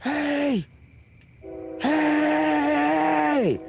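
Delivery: high shouts calling up the ladder; shouting up. Retold or imitated. Calling up the bunker ladder for rescue, high shout. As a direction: call out for help, far and high. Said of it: "I start screaming up there".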